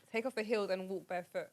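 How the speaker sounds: ordinary talk, nothing marked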